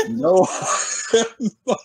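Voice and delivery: laughing through the words. Real, not acted. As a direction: break into laughter mid-line.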